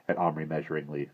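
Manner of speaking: ordinary delivery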